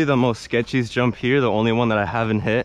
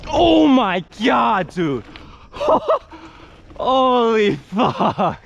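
high, stretched-out exclamations of shock; shocked relief; 10 semitones higher, much slower than usual. Real, not acted. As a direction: gasp it out after a near crash. Scare landing the big jump.

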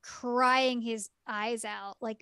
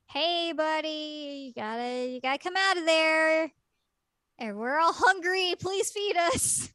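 coaxing plea; 5 semitones higher, 3 dB louder than usual. Retold or imitated. Boys coax him out of room.

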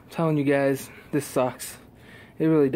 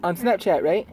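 higher, coaxing voice; 3 semitones higher than usual. Real, not acted. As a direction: uncle coaxing his niece, higher and playful. Uncle talks to his niece.

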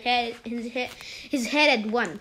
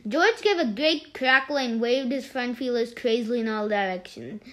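ordinary delivery

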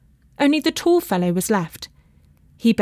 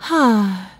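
long falling sigh; drawn-out sighed "ah". A narrator's reading. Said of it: "she sighed".

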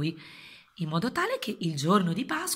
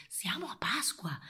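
slow hushed whisper; 4 semitones higher, 12 dB quieter, slower than usual. Acted. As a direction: whisper it like a secret. Whispered correction to kids.

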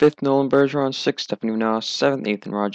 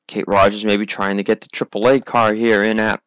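ordinary delivery

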